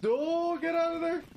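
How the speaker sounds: loud panicked yell; alarmed shout. Real, not acted. Alarmed shout during game attack.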